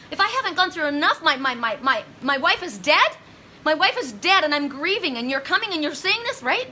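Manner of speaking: a grieving husband's outraged outburst; angry grieving husband. Retold or imitated. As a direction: lash out at the stranger in disbelief, climbing high. Imagined grieving husband lashing out.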